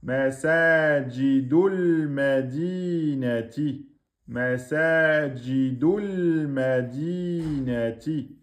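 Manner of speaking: Masajidul madinati slowly, the hamzat al-wasl silent, joined as one word; slow recitation. Shown as a demonstration. Teacher recites the phrase for students, slowly. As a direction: glide masajidul into madinati without a glottal stop. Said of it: "ne se prononce pas".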